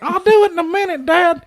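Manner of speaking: put-on very high, whiny teenage voice; teen Rome answering his father in a high whine; impression of Rome. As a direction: squeak it out like a fourteen-year-old boy. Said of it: "spot-on impression of Rome".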